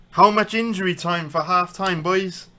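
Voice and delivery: loud, high call across the room. Real, not acted. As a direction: call out to the others. Calling out to people nearby.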